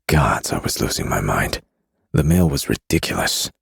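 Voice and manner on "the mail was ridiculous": plain reading voice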